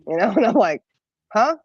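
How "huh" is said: mildly puzzled retold reaction; retold perplexed. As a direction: act out being baffled by someone. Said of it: "still just perplexed by this guy".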